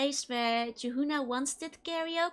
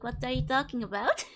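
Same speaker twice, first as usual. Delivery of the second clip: ordinary talk, nothing marked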